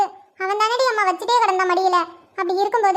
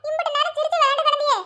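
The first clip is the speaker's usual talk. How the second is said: put-on higher-pitched soothing of a crying baby; lilting, higher grandmotherly baby talk; cooing to baby; 5 semitones higher than usual. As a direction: coo and fuss over a wailing baby.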